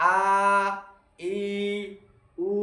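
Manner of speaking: আ, ই, উ stretched long with pauses between; slow drawn-out vowels. Shown as a demonstration. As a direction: drag each vowel out slowly, the wrong way. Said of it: "এভাবে পড়তে পারবে না". (আ, ই, উ টেনে টেনে ধীরে পড়া)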